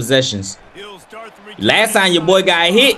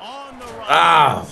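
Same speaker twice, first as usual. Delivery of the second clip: ordinary talk, nothing marked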